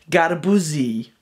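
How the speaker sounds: garbuzi, said slowly and carefully; Greek for watermelon. Shown as a demonstration. Vlogger teaching his friends the Greek word, slow and careful. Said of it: "It's pronounced".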